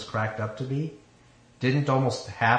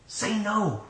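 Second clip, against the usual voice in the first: urging; 9 semitones higher than usual. A narrator's reading. Inner self urging the bride to refuse, high and urgent. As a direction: urge it in a high, tight-held voice. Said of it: "Lois urged again".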